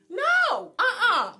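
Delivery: loud, high-pitched no; emphatic refusal. Real, not acted. Insisting she will not do time for others, emphatic refusal. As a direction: shut it down loudly and flatly refuse.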